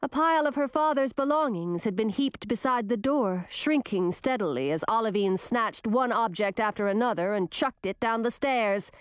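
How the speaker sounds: ordinary delivery, nothing marked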